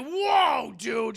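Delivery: high, loud mock shock; startled. Woman shocked by caller's threat, startled. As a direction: recoil with an exaggerated whoa. Imitating her startled reaction.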